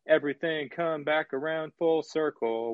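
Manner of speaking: imitating a rapper's monotone chorus, pitched a little up; monotone delivery. Critic mimicking the rapper's chorus to fault it, monotone. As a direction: recite the lyrics on one unvarying note. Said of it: "monotone delivery where he's like".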